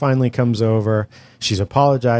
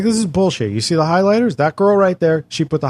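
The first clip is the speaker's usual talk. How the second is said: exasperated outburst; 7 semitones higher, 3 dB louder than usual. Retold or imitated. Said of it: "I was like".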